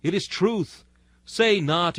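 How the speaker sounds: normal talking voice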